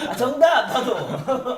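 excited high shout. Real, not acted. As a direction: blurt it out like a quiz answer. Joins in shouting the answer.

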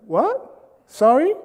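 puzzled query with a steep rise; high rising "pardon?" tone; not hearing. Acted. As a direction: shoot each word up into a question. Acts out straining to hear wife.

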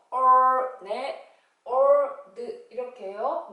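올 said with the tongue curled back, a long r; curled-tongue r sound. Shown as a demonstration. Teacher demonstrating the r sound to kids, tongue curled back. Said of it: "혀를 뒤로 꼬부리라 했죠".

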